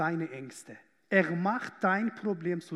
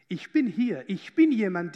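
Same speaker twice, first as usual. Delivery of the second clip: voicing Jesus; 8 dB louder than usual. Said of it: "wenn Jesus sagt".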